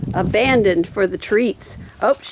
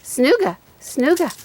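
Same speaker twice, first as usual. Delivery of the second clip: dog talk; 6 semitones higher, slower than usual. Real, not acted. Urging dog to hunt.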